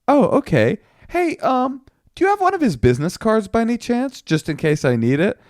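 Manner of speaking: high, overly innocent afterthought; feigned casual cool. Retold or imitated. Man asking police for a business card, feigned innocence. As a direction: ask lightly up high, acting nonchalant. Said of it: "cool as a cucumber".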